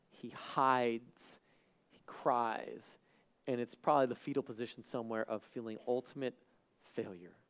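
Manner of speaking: low, soft narration with long pauses; sombre, subdued preaching; hushed sermon storytelling. Acted. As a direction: let each phrase fall quietly into silence. Preacher narrates Peter's grief, hushed.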